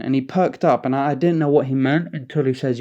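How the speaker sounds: normal talking voice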